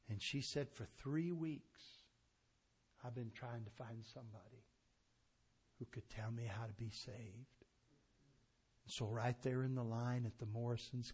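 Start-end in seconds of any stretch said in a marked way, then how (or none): 0.7-7.6 grieving mother. Retold or imitated. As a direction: hush down as she speaks of her buried boy. Mother's searching plea.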